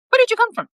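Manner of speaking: a spooked cook confronting the intruder; startled demand. Acted. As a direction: blurt it out, still rattled. Bob startled by sudden woman.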